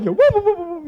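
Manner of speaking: high repeated comic whoops; cartoon scurrying sound; running-away noises. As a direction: whoop along as if fleeing.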